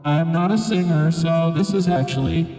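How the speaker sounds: robotic voice locked onto held notes; auto-pitched vocoder. Shown as a demonstration. Reviewer who's not a singer demonstrating classic auto-pitch sound. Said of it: "my voice has that auto pitch sound".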